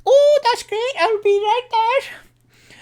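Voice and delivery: very high, loud sing-song wife calling back; halfling wife. Acted. The halfling wife answering her husband from afar, very high. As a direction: call out from the next room, very high.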